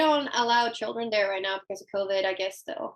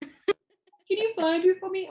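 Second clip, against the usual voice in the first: slow, drawn-out pleading, higher voice with a laugh; mock pleading husband; 7 semitones higher, much slower than usual. Husband whining to his wife to find his gear. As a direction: whine it like a helpless husband. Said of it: "husband asks again".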